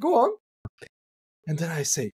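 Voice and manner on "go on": high, coaxing "go on"; high encouraging voice. Retold or imitated. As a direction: sing it up to coax them. Instructing client in retold scene.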